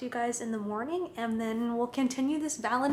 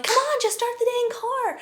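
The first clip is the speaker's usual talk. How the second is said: frantic urging; 13 semitones higher, 8 dB louder than usual. Retold or imitated. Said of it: "and you're like".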